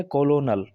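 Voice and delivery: "कोलोनल" as ko-lo-nal, three syllables; naive spelling reading. Shown as a demonstration. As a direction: misread "कोलोनल" with the L sounded. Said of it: "ज़ाहिरी तौर पर ये क्या है". ("कोलोनल", ल के साथ ग़लत उच्चारण)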